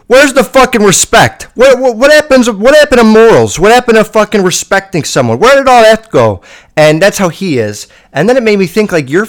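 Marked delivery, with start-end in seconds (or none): 0.1-6.4 put-on high, loud indignant rant as an old man; imitating papa railing against lost morals; outraged grandpa. Grandson imitates papa, loud and high with indignation. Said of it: "my papa was like".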